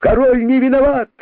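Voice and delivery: high loud cheering cry; crowd shout. A narrator's reading. Rabbits cheering for their king, shouting. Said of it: "закричали кролики".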